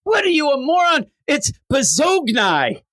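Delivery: high, hammed-up correction; mock scolding mother. Mother correcting her child's pronunciation, mock scolding.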